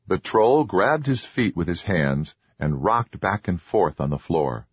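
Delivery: usual speaking voice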